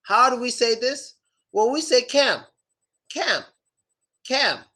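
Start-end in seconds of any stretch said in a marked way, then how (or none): 2.0-4.6 "cam" ending on closed lips; final M. Shown as a demonstration. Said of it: "just closing your lips".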